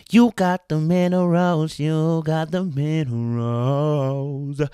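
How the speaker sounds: slow, loud drawn-out hype chant; hype catchphrase. Acted. Hyping the fans with a catchphrase, drawn out and loud. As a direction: stretch it out like a hype man.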